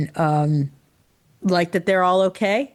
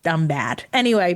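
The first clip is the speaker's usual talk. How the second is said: normal talking voice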